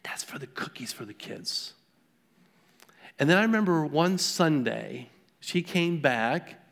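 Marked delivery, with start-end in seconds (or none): 0.0-1.7 put-on hushed, near-whispered little old lady voice; soft elderly lady. Pastor voicing the elderly church lady handing him a dollar, hushed. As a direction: breathe it softly like a shy, frail old woman. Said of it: "she's just a petite, tiny, uh, elderly lady".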